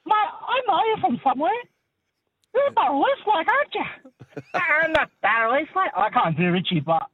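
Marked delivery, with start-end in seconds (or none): 0.1-5.9 very high squeaky cabbie voice; high-pitched taxi driver. Retold or imitated. Caller acts out the cab driver's line in a very high voice. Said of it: "he's like".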